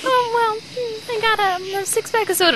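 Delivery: high, hesitant mock boyfriend voice; timid boyfriend answering his angry girlfriend, high and unsure; meek boyfriend.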